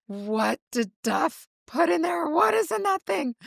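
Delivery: high-pitched inner panic, retold; panicked thought. Retells her panicked thought at customs.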